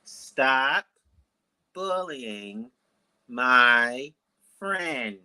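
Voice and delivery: one word at a time, long pauses between; slow, spaced-out solemn delivery; slow, deliberate. Shown as a demonstration. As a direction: give each word monumental weight. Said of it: "I'm gonna say it slowly".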